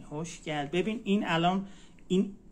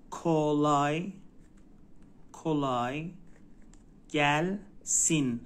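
drawn-out syllables for learners to repeat; slow teaching demo; much slower than usual. Shown as a demonstration. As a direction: stretch each word, pausing between. Teacher models phrase syllable by syllable. (شمرده و کشیده، هجا به هجا)

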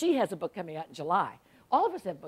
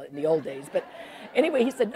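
ordinary delivery

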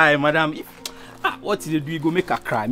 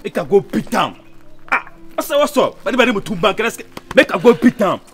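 high, loud, agitated outburst; fired-up threat; 10 semitones higher, 4 dB louder than usual. Acted. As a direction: rage loudly in a raised pitch. Friend threatens to beat the rival.

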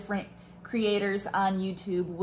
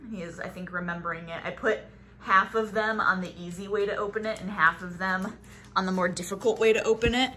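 normal talking voice

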